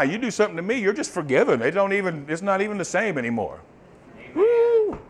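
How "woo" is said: high, sudden joyful whoop. Real, not acted. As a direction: let out a burst of real joy. Joyful outburst mid-sermon.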